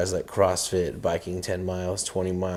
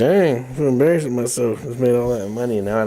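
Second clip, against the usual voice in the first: lightly voiced, a bit higher and louder, joking self-pity; mock broke Elon Musk; 4 semitones higher, 5 dB louder than usual. To the host, mocking a broke Elon Musk, lightly voiced. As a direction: play a rich man suddenly broke, half laughing at himself.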